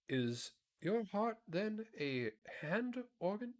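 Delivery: high, slow curious pumpkin voice; curious Pumpkinhead. A narrator's reading. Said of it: "asked the Pumpkinhead curiously".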